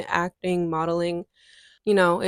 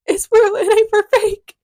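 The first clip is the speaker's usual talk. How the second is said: excited exclaim; 13 semitones higher, 7 dB louder than usual. Retold or imitated. Retold realization, emphatic exclaim.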